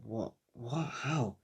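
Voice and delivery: very soft, stunned questions; hushed disbelief. Acted. Deku realizes that he is being healed, hushed disbelief. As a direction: ask under your breath, baffled. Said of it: "Deku realizes".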